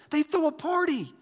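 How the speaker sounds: high-pitched jubilant announcement; celebrating. Acted. Preacher telling how heaven greets repentance, jubilant and high. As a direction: proclaim the party with delight.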